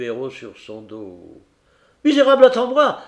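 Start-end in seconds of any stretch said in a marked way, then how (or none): 2.0-3.0 high, loud challenge to a thief; furious yell. Acted. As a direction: yell it while charging at him. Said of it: "hurla le Tarasconnais". (hurlement indigné, aigu et fort)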